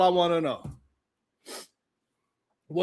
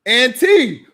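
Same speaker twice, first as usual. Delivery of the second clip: loud, raised call; 4 semitones higher, 9 dB louder than usual. Real, not acted. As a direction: call Auntie out sharply and high. Calling out to a chat member.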